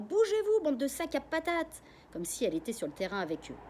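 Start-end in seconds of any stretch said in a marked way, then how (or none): 0.0-1.8 high, loud scolding shout; furious fan yell. Acted. Mother yelling at footballers on the TV, angry shout. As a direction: bawl out the useless players. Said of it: "elle crie aux joueurs".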